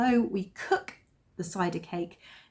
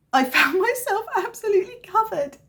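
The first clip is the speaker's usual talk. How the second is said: laughing; 11 semitones higher, 3 dB louder than usual. Real, not acted. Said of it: "laughing retelling".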